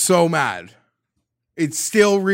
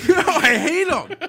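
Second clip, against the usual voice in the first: laughing; 5 dB louder than usual. Real, not acted. Laughing after his rant.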